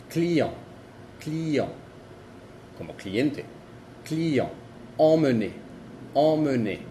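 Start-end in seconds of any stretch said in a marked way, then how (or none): 0.1-1.7 client with a nasal end; French client, nasal; French demo word. Shown as a demonstration. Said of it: "el mismo sonido que la A N". 5.0-6.8 emmener with a nasal first vowel; French emmener, nasal start; French demo word. Shown as a demonstration. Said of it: "el mismo sonido".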